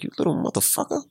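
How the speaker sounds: hushed, half-whispered mock curse; muttered inner thought. Parent retells a silent thought about kid, hushed mutter. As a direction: say it under your breath. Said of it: "I said it in my, my head".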